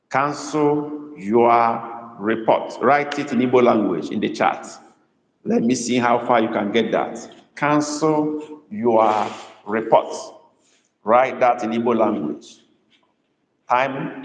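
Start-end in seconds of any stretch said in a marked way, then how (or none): 0.1-2.7 very slow, spaced-out words; slow dictation. Shown as a demonstration. Tutor asking students to write it in Igbo, slow dictation. Teacher dictates sentence for translation. 7.6-10.1 word by word, very slow; slow dictation. Shown as a demonstration. Tutor repeating the sentence for the chat, slow dictation.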